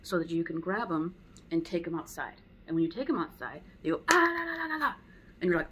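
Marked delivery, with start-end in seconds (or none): 4.1-4.9 loud, high babbled yell; high-pitched alarm babble; startling noise. Shown as a demonstration. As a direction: make a sudden racket to interrupt the dog. Demonstrating noise that startles dog.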